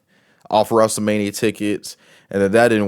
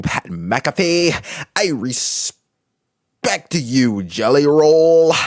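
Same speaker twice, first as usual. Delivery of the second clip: higher, slow, drawn-out dramatic wrestler promo; Cody Rhodes voice; 5 semitones higher than usual. Retold or imitated. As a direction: ham up a solemn babyface speech, dragging each line. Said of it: "I'm gonna do my Cody Rhodes voice".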